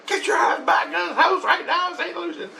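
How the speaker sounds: very high mock yell; wife yelling. Wife orders husband home, high mock yelling. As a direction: imitate a wife shrieking orders. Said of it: "My wife's yelling at me".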